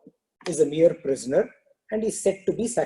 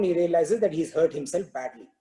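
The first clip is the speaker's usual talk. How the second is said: normal talking voice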